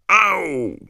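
very high acted yelp; narrator's sharp cry of pain; pained yell. A narrator's reading. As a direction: yelp as if suddenly hurt. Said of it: "wrzasnął Dean Thomas".

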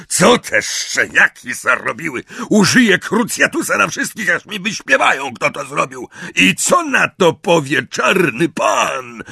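high, loud, panicked raging; furious, frightened roar. A narrator's reading. Amisius finds his sister lying still, roaring in fury and fear. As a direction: rage in fear of the master. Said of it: "Ryknął z wściekłości i strachu".

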